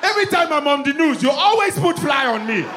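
put-on high, indignant African accent; complaining African interviewee. Interviewee complains to news crew, indignant African accent. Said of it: "He's trying to talk".

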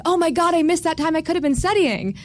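high-pitched mock panic over lost study time; a hint of frantic student worry; retold panic. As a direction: blurt it out like you just realised you wasted hours. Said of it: "and I would think".

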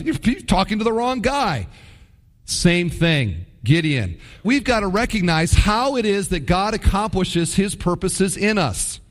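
ordinary talk, nothing marked

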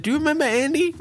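retelling his backstage question in a high voice; high mock quote. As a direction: pitch it way up as you retell. Quoting his own question backstage.

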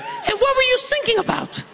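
very high child imitation; piping kid quoting the clip. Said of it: "from the back seat, I heard".

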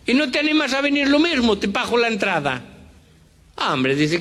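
very high, coaxing Galician voice; Galician accent. Acted. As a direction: coax a friend in a high Galician voice. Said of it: "este cuento de gallegos".

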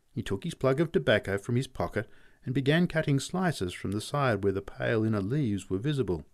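usual speaking voice

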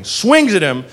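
ordinary delivery

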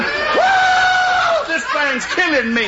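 high whoa, then panicked yelling; comic panicked cry for help. Retold or imitated. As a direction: scream down from the treetop. John attacked in tree.

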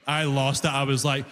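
ordinary talk, nothing marked

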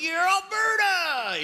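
high, drawn-out mock cheer; hammed-up hometown whoop; cheering insider. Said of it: "when you're in Alberta, you're like".

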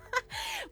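short squeaky burst of laughter; high giggle. Real, not acted. Laughing at mom's saying.

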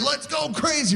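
high, excited mock party cry; giddy parents. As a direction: hype it like freed parents. Parents out without kids.

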